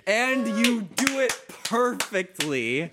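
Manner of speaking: high, loud, drawn-out announcement; triumphant proclamation. Real, not acted. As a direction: proclaim it slowly and loudly, thrilled. Game master proclaims critical success.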